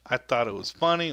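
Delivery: ordinary talk, nothing marked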